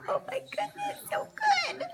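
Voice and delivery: delighted squeal. Real, not acted. Delighted watching baby eat.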